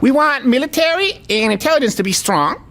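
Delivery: high mock Chinese accent; caricatured Chinese officer in broken English; Chinese military leader impression. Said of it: "doing an impression of a Chinese military leader".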